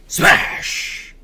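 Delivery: breathy sound effect smash; mock crash noise; whispered smash. Sound effect inside the story.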